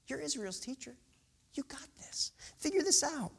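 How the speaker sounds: soft, high whisper of trust; Jesus urging Nicodemus, in a soft whisper; whispered encouragement. Acted. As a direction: breathe it as a gentle nudge.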